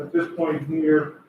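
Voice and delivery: normal talking voice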